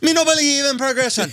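put-on a playful Jamaican accent refusing progress; mock Jamaican accent. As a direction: Jamaican patois, a bit high and jokey. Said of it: "good Jamaican sound".

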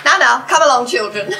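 mock schoolteacher; director calling the cast to order. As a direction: clap them back to order like naughty kids. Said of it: "clapped his hands and said".

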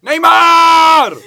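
loud excited shout. Real, not acted. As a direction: yell the name out. Shouts the name.

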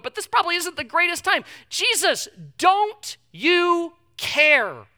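high, loud preaching, each don't you care? pushed hard; pleading preaching. Acted. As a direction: hammer the plea home to the crowd. Preaching the disciples' plea.

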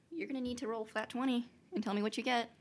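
ordinary talk, nothing marked

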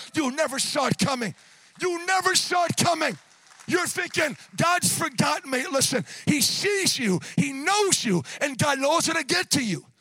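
rising to a loud, urgent sermon climax; impassioned preaching. Real, not acted. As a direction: burst out with fervent assurance to the congregation. Preaches at full force.

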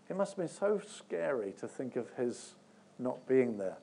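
ordinary delivery

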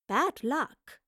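high, lilting taunt; smiling taunt. A narrator's reading. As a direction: tease a beaten rival with a smile. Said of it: "the professor smiled at Raleigh".

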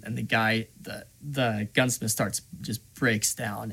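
ordinary delivery

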